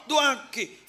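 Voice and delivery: doaki called out high and loud, falling at the end; loud summons to pray. Shown as a demonstration. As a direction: belt out doaki as a call to pray. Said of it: "Yuk, cek lebih temen Allah".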